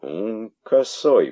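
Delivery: threatening. Acted. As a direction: menace him. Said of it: "пригрозил царь".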